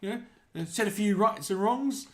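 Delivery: high, laughing New Zealand bro voice; New Zealand accent. Pretend. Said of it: "they're based on New Zealand".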